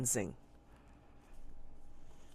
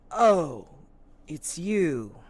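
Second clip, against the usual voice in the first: recognition; 4 semitones higher, 8 dB louder, much slower than usual. Acted. Constable recognizes the man.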